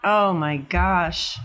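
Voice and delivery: usual speaking voice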